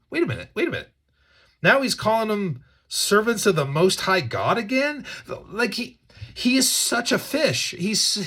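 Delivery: ordinary delivery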